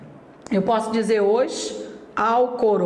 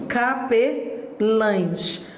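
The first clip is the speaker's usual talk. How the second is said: slow demo word; much slower than usual. Shown as a demonstration. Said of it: "Plural de capelão".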